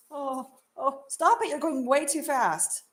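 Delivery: high, loud alarmed yelp; panicked stop. Real, not acted. Telling cameraman to stop, loud alarmed yelp. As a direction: shout him to a stop. Tells cameraman he cuts too fast.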